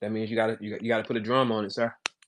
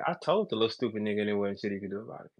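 smug told-you-so; 5 semitones lower, 9 dB quieter, faster than usual. Pretend. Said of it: "come back here and say".

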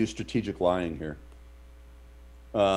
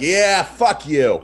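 loud, drawn-out, higher curse; slow, raised-voice taunt; retold angry comeback; 6 semitones higher, 5 dB louder, much slower than usual. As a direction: act out yelling it after someone. Drunken yelling back at driver.

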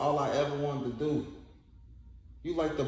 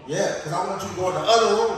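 angry; 5 semitones higher, 6 dB louder, faster than usual. Acted. Acted quarrel continues.

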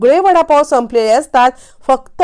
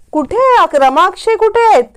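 high, raised-voice demand; mild acted yell; 8 semitones higher than usual. Mangal asks the grandmother on the phone, mild high yell. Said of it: "किती जोराने ओरडतेस".

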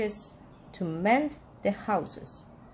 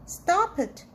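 higher, half-shouted storybook protest; mild shout; 6 semitones higher than usual. A narrator's reading. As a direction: shout it lightly, higher in pitch. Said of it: "shouted Keeper".

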